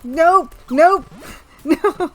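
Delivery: panicked nope. Real, not acted. Panicked laughing refusal.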